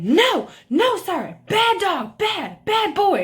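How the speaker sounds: high, slow mock scolding; imitated screaming. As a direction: mimic a neighbor shrieking at a dog, drawn out. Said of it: "she is screaming at Kevin".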